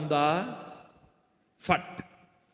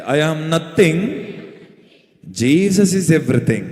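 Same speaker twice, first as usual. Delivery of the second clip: louder, very slow and drawn out; 4 semitones lower, 6 dB louder, much slower than usual. Shown as a demonstration. Preacher asks the congregation to say it louder, slow and loud. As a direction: stretch every word for the crowd to echo. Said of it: "గట్టిగా చెప్పండి".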